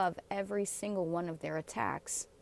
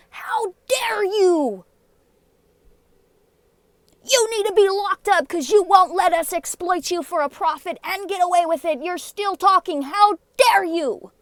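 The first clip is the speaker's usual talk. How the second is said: much higher, much louder furious sarcasm; shouted mock outrage; 10 semitones higher, 12 dB louder than usual. Yelling mock fury at police and accusers. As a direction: scream their hypocrisy back at them.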